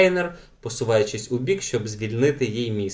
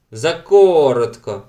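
loud, slow protest; exclaimed; 7 dB louder, slower than usual. A narrator's reading. Knut objects the kiss was too short, loud. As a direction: call it out, drawn out. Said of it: "вигукнув Кнут".

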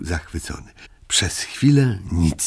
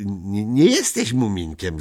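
ordinary delivery, nothing marked

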